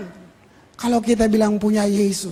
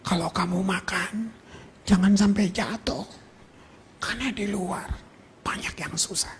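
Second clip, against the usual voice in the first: hushed quote; 3 semitones lower, 7 dB quieter than usual. Retold or imitated. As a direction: let the words fall to a hush. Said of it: "Ngomong buat rakyat".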